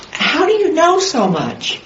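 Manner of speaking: high, wide-eyed amazed question. Retold or imitated. Student asks the little man how he knows so much, amazed. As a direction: ask it in genuine astonishment, pitched high.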